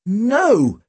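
high-pitched indignant protest. A narrator's reading. Tim protesting he won't faint, indignant. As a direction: deny it, voice shooting up. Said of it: "Tim was indignant".

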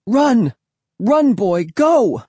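high, loud yelled commands; urgent shouting. A narrator's reading. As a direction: yell to send the dog fleeing. Child urging dog to flee.

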